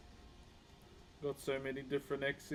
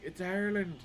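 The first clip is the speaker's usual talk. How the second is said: put-on Irish girls' accent, pitched a bit higher; imitated Irish accent; 4 semitones higher, 4 dB louder than usual. Streamer imitating Irish girls at the World Cup, higher Irish accent. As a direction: mimic an Irish accent, lifting the voice. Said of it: "Even the girls were like".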